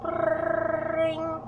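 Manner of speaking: high, soft, trilled "ring"; softly mimicked ringtone; imitated phone ring. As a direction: trill it like a ringing phone, held on one high note. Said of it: "my coat would ring".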